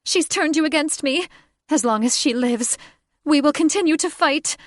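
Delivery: high, loud accusing cry; furious bird woman. Acted. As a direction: screech it at the man she loves. Catherine raging.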